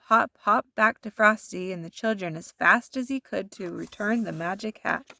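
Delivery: ordinary delivery, nothing marked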